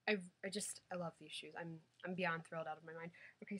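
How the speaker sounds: ordinary talk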